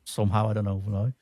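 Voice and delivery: normal talking voice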